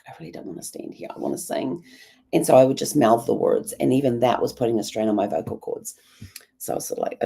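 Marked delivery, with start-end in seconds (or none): 0.1-1.8 whispering. Retold or imitated. Inner thought while forced silent in church.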